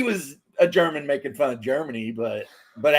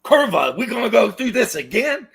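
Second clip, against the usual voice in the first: a burst of mock Polish exasperation; exasperated Pole; 8 semitones higher, 5 dB louder than usual. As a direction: groan it like history is repeating itself. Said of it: "someone's being all like".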